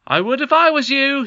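high, loud teasing call; taunting call. A narrator's reading. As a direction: call it out cheekily. Curdie taunting loudly.

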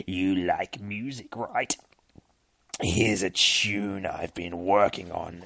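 low, slow monster voice; demon spawn. Acted. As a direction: taunt with relish, dragging the words out. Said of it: "motherfucking demon spawn".

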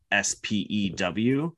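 S P E W said slowly as four separate letters; spelled out. Shown as a demonstration. Host explaining what Hermione meant, S P E W spelled out slowly. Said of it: "what she meant was".

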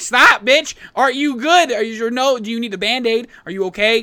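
loud, high exasperated pleading; retold frantic concern. Visitor to the actor he kicked, loud frantic mock concern. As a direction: raise your voice, insist they answer you.

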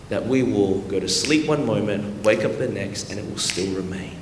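low, soft, earnest sermon voice; quiet, weighty pulpit reflection; hushed preaching. Real, not acted. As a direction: let it sink almost to a hush.

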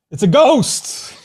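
high, loud spooked shriek of the disciples; mock terrified cry. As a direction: ham up the disciples' fright, slowly.

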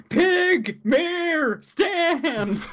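high shouted outburst; shouting. Retold or imitated. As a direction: yell each word way up high. Acting out Polnareff shouting.

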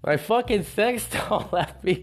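high, slow, half-laughing mock despair; high, laughing mock despair over a leaving sex doll; laughing disbelief. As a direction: laugh through fake heartbreak.

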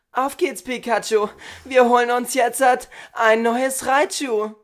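high, loud cartoon trainer cheer; hammed-up anime rallying cry, stretched at the end; Pokémon voice. Shown as a demonstration. Podcaster shows off a Pokémon voice, high excited trainer call. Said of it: "das ist so wie meine Pokémon-Stimme".

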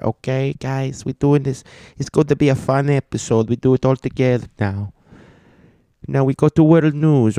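put-on character voice.

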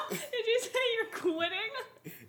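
high, slow laughing question. Real, not acted. Laughing mock disbelief.

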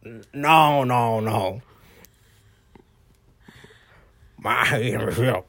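mock Creole mumble. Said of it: "say it in, uh, Creole".

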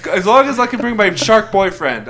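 high, loud mock-bashful condition; giddy. Itachi asks to bring the boyfriend along, giddy. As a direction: blurt it high through amusement.

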